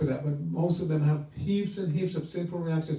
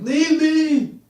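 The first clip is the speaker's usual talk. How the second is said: high-pitched panicked yell, imitating a dying man; acted-out cry to be left alone; 10 semitones higher, 5 dB louder, slower than usual. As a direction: yell it high, driving someone away. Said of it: "He was yelling out".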